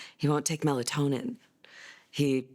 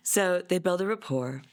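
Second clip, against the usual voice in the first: ordinary talk, nothing marked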